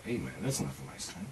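hushed private resolve; quiet inner voice. Retold or imitated. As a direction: murmur it low to yourself. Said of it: "I said to myself".

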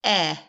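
teacher modeling short e; short e sound. Shown as a demonstration. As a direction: sound out "eh" clearly. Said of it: "The next sound is".

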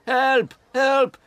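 very high, loud panicked cries; man on the ground yelling for help; shouting for help. A narrator's reading. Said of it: "He shouted".

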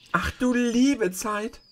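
high, slow astonished exclamation; surprised cry. Acted. Dorothy hears she meets a witch, astonished. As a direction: gasp it out in wonder. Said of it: "rief Dorothy".

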